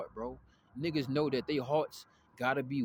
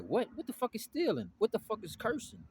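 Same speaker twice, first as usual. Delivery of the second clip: put-on young self; 8 semitones higher than usual. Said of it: "I'm like".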